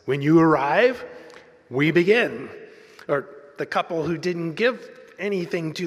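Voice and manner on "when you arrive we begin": hint of a booming promo announcer. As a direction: deliver it as a punchy announcer promise. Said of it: "the voice says".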